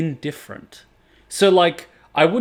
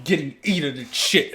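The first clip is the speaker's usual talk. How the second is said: normal talking voice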